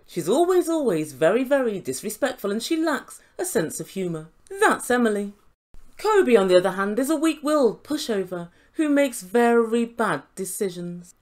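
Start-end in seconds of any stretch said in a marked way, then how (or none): none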